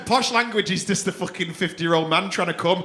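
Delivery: ordinary talk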